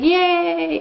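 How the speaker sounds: high squeal of joy; a delighted little cheer; squeaky high celebratory yay; happy cheer. Acted. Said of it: "He's like".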